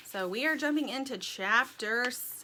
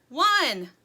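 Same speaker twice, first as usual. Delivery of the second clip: loud, higher count; yelling; 5 semitones higher, 9 dB louder than usual. A narrator's reading. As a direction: shout your number for roll call. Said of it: "yelled Dirt".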